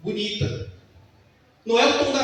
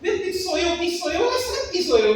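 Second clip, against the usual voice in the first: retold nervous panic; 7 semitones higher than usual. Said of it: "E eu fiquei nervoso".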